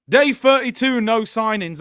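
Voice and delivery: loud, high Geordie announcer voice booming the day count; a Geordie Big Brother host announcing the day; Geordie Big Brother narrator. Retold or imitated. Arsenal fan mocking the transfer window as a Geordie Big Brother host. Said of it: "that was my Geordie accent of the guy who presents, uh, Big Brother".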